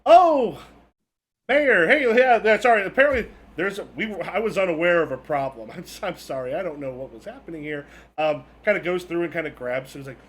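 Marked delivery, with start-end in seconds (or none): 0.0-7.9 flustered manager. Acted. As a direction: gush apologies to the mayor. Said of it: "the manager goes".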